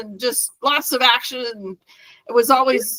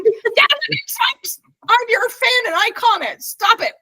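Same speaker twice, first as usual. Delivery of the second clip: high mock-indignant protest; hammed-up outrage, pitched way up; retold yelling; 13 semitones higher than usual. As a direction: imitate a fan yelling her complaint. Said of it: "She's yelling at me".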